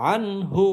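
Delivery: "আনহু" with a clean n, no nasal hum; clear noon. Shown as a demonstration. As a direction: say the n of "আনহু" distinctly before the h. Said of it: "গুন্নাহ ছাড়া স্পষ্ট করে পড়তে হয়".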